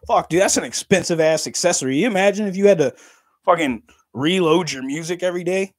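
normal talking voice

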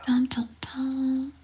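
slow whispered dun dun dun; mock dramatic whisper; whispered suspense sting. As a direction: breathe it like a spooky reveal.

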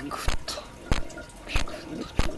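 voiceless whispered ビクッと twice. Real, not acted. Gamer whispers about being startled, voiceless. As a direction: breathe the word softly.

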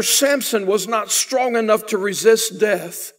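ordinary talk

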